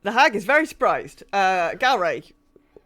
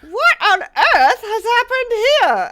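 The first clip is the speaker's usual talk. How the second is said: high, slow shrieking hag; old hag voice; 11 dB louder, slower than usual. Acted. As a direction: screech it like an old crone. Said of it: "and the hag says".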